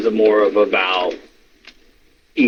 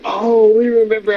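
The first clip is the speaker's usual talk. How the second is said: mock crowd; 10 semitones higher than usual. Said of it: "everybody's like".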